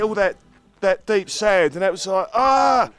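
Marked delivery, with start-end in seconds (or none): none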